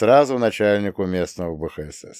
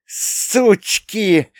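hissed; 10 semitones higher than usual. A narrator's reading. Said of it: "прошипела та".

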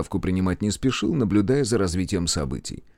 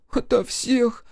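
put-on much higher, girlish voice; a scared village girl answering; timid high young girl; frightened girl; 14 semitones higher, slower than usual. A narrator's reading.

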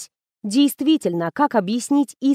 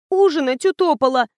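higher, tearful whine; mildly plaintive, pitched up; whiny; 5 semitones higher than usual. A narrator's reading. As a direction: whine it like a put-upon girl. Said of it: "плаксиво протянула Алиса".

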